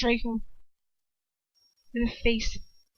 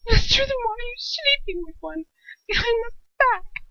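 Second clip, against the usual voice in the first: much higher, crying accusation; bursting out; 12 semitones higher than usual. A narrator's reading. Draco accusing his father through tears. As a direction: throw the accusation near tears. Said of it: "he bursts out".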